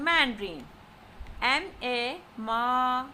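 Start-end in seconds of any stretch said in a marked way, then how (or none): 2.4-3.1 "ma" held level and long; first tone. Shown as a demonstration. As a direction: hold "ma" on one steady note. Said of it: "with first tone".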